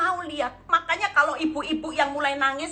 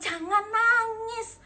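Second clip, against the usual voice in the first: high, drawn-out order not to cry; retold firm command, pitched high; stern command; 10 semitones higher, much slower than usual. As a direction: tough counselor's drawn-out reproof. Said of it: "saya agak keras".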